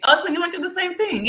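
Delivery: high, sympathetic surprise; surprised. Real, not acted. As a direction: react warmly to a chat comment. Reacting to viewer comment.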